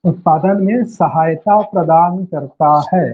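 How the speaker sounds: slow, measured teacher's dictation. Real, not acted. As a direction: dictate it slowly, drawing out each word. Teacher dictating the definition slowly. (धीरे-धीरे बोलकर लिखवाना)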